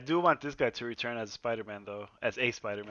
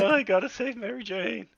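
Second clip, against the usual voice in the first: high-pitched mock hero whimper; Tobey Maguire impression; 11 semitones higher, 3 dB louder than usual. Comic mocking Tobey's Spider-Man for friends, high mock-whiny impression. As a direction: whine it high, hamming up the heroics. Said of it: "Tobey Maguire in his head".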